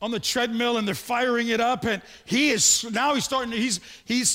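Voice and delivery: ordinary talk, nothing marked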